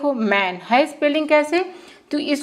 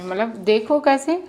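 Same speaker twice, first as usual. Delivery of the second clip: normal talking voice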